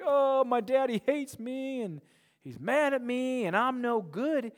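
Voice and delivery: put-on high, slow, mopey child voice; whiny child. Pastor mimics a sulking believer, mopey. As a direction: sulk like a kid who thinks dad hates him. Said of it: "Don't be like".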